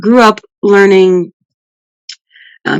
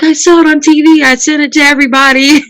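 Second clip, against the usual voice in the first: excited grandmother; 9 semitones higher than usual. Retold or imitated. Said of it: "She's like".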